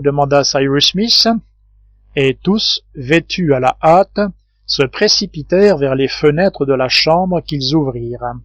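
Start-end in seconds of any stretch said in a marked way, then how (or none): none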